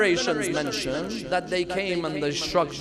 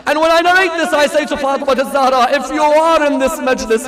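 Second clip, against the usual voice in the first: mournful preaching; 4 semitones higher, 8 dB louder than usual. Real, not acted. Preacher telling the congregation of a dream, mournful preaching. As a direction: let the grief carry, stretching key words.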